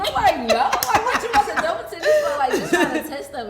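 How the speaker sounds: cracking up mid-story; laughing through the retelling. Real, not acted.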